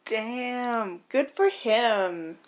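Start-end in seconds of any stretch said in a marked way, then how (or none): none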